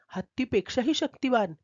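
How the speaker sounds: ordinary delivery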